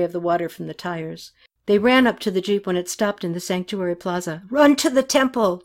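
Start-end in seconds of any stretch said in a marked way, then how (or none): 4.5-5.6 moderately raised, higher shout; narrator's restrained acted yell; yelled order. A narrator's reading. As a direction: order them to safety. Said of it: "the driver yelled".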